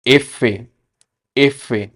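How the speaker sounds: एफे as Spanish "efe", two clear syllables; Spanish letter name. Shown as a demonstration. Teacher to students, careful Spanish letter name. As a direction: model एफे slowly. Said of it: "एफ को क्या कहते हैं".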